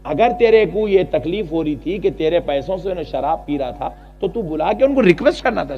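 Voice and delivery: usual speaking voice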